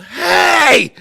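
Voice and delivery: yell. Retold or imitated. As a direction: yell it at the top of your lungs. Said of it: "I stood up and I yelled".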